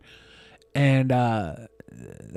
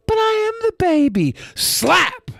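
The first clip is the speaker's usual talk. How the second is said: mocking high whine; slower than usual.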